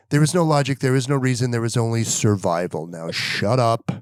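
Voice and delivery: stern warning. Retold or imitated. Dad ordering son out of danger, stern. Dad laying down survival rules.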